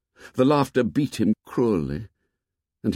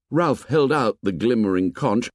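plain reading voice

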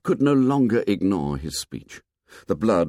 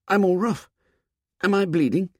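put-on frightened boy; 11 semitones higher, faster than usual. Scared boy feels his face.

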